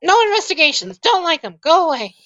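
high-pitched, short refusals from a grumpy character; grumpy shut-in. Acted. Resident refusing to talk to investigators at the door, grumpy high voice. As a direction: send them packing in a high voice. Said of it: "you hear someone say".

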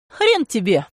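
mildly tart rebuff; curt refusal. A narrator's reading. Woman refusing the hungover man, mildly defiant. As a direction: refuse him with a touch of defiance.

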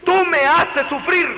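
tú me haces sufrir, pitched high and loud; accusing. Shown as a demonstration. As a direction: hurl the blame at the child. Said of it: "No le dijo".

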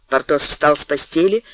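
ordinary delivery, nothing marked

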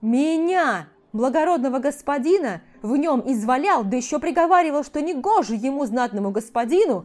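loud, furious noble ranting; indignant shouting. A narrator's reading. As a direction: rage at the crowd, loud. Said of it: "Продолжал негодовать эльф".

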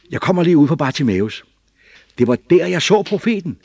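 put-on high, loud girlish voice; a little girl bursting to be believed; excited child telling her parents; young girl Rachel, eager.

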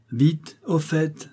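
very slow, drawn out; slowed, impatient. Acted. Pharmacist pressing his wife, slowly. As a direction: drag each word out.

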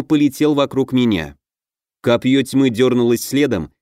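plain reading voice

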